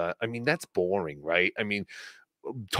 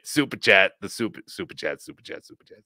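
mock mindless chant fading to a near-whisper; hypnotized repeating; 7 semitones lower, 4 dB quieter than usual. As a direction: keep repeating it, sinking lower and softer. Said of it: "Just kept saying Super Chats".

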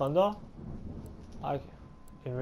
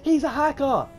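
panicking gamer; 6 semitones higher than usual. Real, not acted. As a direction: yell the accusation. Gamer accusing a hacker.

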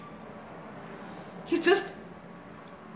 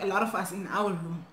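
ordinary talk, nothing marked

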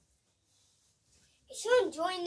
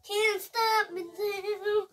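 hero catchphrase; 7 semitones higher, 3 dB louder, much slower than usual. Retold or imitated. Quote again over music.

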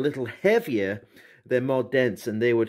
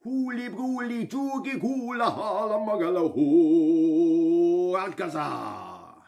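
slow, louder spell then fading; magic words; 3 semitones higher, 3 dB louder, slower than usual. Pretend. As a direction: intone it like a hammy wizard. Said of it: "When I say them words".

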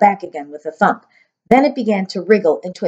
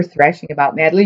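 plain reading voice

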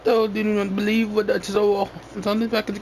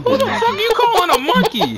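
much higher indignant shout; indignant yell; 3 dB louder than usual. Real, not acted. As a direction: yell back, offended. Mock offense at insult.

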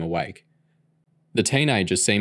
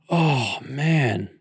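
pitched up, queasy gasp; 5 semitones higher than usual. A narrator's reading. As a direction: groan it out after throwing up. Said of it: "Curtis gasped".

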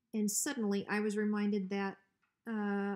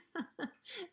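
nervous laugh; 10 semitones higher, 5 dB quieter than usual. Acted. Hank covering his insult.

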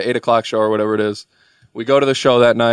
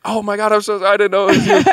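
put-on embarrassed girlfriend; 9 semitones higher than usual. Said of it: "Bailey's embarrassed as hell".

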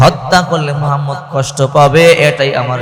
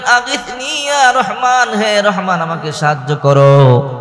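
high, slow, drawn-out plea; pleading prayer; 6 semitones higher than usual. Shown as a demonstration. Preacher shows listeners how to call God, pleading. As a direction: cry out to God for help. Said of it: "আল্লাহর নাম ধরে ডাকবেন".

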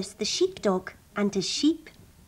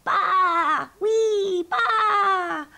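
high, loud baas and whee; whooping bleats; 9 semitones higher, 6 dB louder, much slower than usual. Acted. Sheep sliding down the slide, bleating and whooping high.

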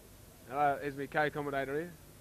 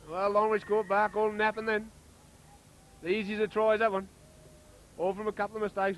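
1 excited; 6 semitones higher, 5 dB louder than usual. Real, not acted. After the try.

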